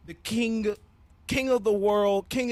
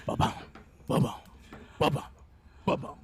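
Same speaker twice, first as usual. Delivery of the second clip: imitated drum beat; 4 semitones lower than usual. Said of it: "you start hearing the drum beat".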